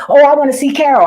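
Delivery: high, loud mocking whine; high mocking imitation of a visitor; mocking whiny visitor. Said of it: "I don't give a fuck who coming".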